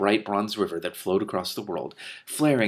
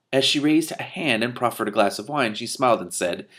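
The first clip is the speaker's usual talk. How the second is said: ordinary delivery, nothing marked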